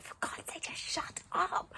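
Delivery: whispered, slow scolding of the dog; whispered telling-off to a barking dog; hissed whisper. Retold or imitated. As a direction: hiss it at the dog without being heard. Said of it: "going to the dog".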